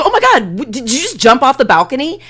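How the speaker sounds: high, loud, fast shocked gasp; shocked Jasmine. Retold or imitated. Jasmine sees him leap off the balcony, shocked and loud. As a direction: gasp in alarm at his jump. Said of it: "she's like".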